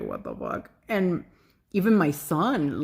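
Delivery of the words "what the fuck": very low, hushed aside; mock murmur of disbelief, dropped way down; imitated baffled onlookers. Audience seeing her cry, hushed bafflement.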